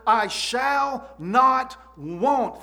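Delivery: very slow, word-by-word emphasis; emphatic declaration. Retold or imitated. Preacher quotes David's psalm to the congregation, slow and emphatic. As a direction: stretch out each word as a firm declaration.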